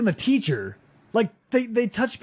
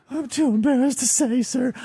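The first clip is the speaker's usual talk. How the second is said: higher, mock embarrassed voice; pitched-up sheepish impersonation; embarrassed suspect; 4 semitones higher than usual. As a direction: imitate a mortified man pleading with an officer. Mimics embarrassed suspect refusing to answer.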